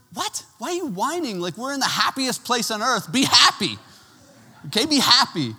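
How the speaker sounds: high-pitched, incredulous parental scolding; mock-exasperated father, high and punchy; exasperated dad. Exasperated dad scolds whining son.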